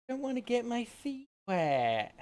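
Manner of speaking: high, slow whiny creature voice; squeaky mount voice. Pretend. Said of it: "Mounts go".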